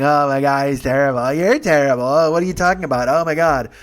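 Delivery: loud imitation of bickering passengers; mock yelling. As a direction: yell over each other, exaggerated. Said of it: "they're yelling".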